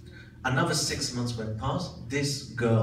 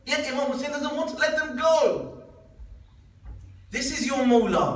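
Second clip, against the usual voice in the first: high, raised preacher's cry; impassioned preaching; 11 semitones higher, 4 dB louder than usual. Acted. Preacher exalts his Imam to the congregation, impassioned. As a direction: proclaim it to the congregation, high and ringing.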